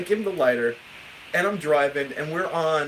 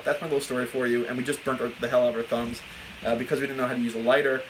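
usual speaking voice

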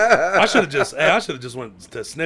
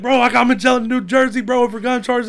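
high, very loud phone plea; retold frantic shouting; 11 semitones higher, 10 dB louder than usual. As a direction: shout the bad news to your brother. Retelling his frantic jail phone call.

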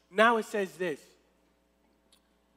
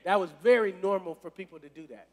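usual speaking voice

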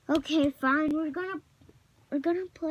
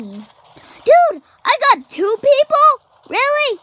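high, loud disbelieving outburst; excited yelling; 11 semitones higher, 11 dB louder than usual. Real, not acted. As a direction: yell it in startled disbelief. Startled in a game fight.

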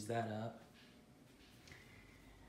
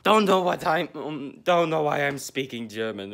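loud self-mocking; 3 semitones higher, 18 dB louder than usual. Real, not acted. Laughing at himself, loud.

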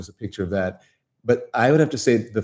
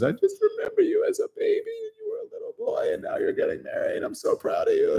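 much higher, breaking into a yell; imitating tearful mom; 4 dB quieter than usual. Son imitating his crying mother before the ceremony, high and tearful. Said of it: "She goes".

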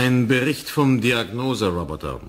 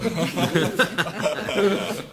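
mocking laugh; 8 semitones higher than usual. Mocking laugh at Hall's story.

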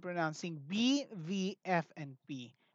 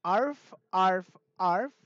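playful puppy barks for the class; puppy arf; 3 semitones higher, 10 dB louder, slower than usual. Shown as a demonstration. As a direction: yip like a little puppy. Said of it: "Last one".

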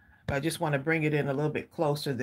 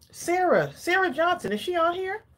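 excited; 11 semitones higher than usual. Real, not acted. Calling out a viewer's name.

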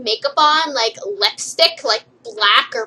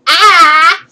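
a sudden loud scream, much louder; full-lung scream, higher and much louder; screaming at the top of lungs; 5 semitones higher, 14 dB louder than usual. Pretend. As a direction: scream for no reason as loud as you can. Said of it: "Scream at the top of your lungs".

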